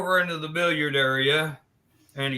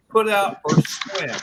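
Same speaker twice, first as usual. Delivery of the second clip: slow, unhesitating delivery; confident, deliberate demand; firm ultimatum; 3 semitones higher, 4 dB louder than usual. Acted. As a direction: lay down the choice, slow and sure. Friend models the ultimatum.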